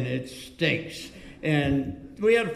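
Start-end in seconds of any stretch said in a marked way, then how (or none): 0.0-1.1 unmoved, deadpan repeat of a verdict; very frank. Retold or imitated. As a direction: imitate a blunt friend refusing to budge. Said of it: "very frank".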